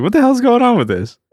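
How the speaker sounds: high-pitched mock bewilderment; exaggerated baffled exclamation; retold bafflement. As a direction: pitch it way up in disbelief. Retells own shocked reaction to news.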